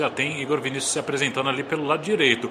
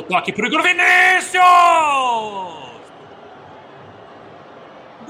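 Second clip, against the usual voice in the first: shouted goal call, drawn-out vowels; explosive goal commentary; 11 semitones higher, slower than usual. Acted. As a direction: scream the goal, then stretch it. Goal call by play-by-play narrator.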